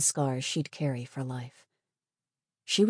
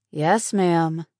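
louder, dry compliance; 3 semitones higher, 8 dB louder than usual. Acted. Patient replying to the nurse's rules, dry. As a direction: answer the orders with dry obedience. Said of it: "replied in a dry tone of voice".

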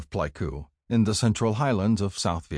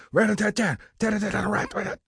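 high, rapid-fire rat-a-tat imitation; child's machine-gun noises; 14 semitones higher, faster than usual. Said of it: "I joined in".

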